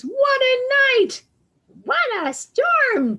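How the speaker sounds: a small animal bursting in from the storm; excited mole voice. Acted. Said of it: "Mole mutters".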